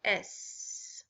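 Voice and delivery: "S" as a bare hissed /s/ sound, no vowel; isolated s sound. Shown as a demonstration. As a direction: say "S" as the hissing sound s-s-s. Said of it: "The first sound in sit is".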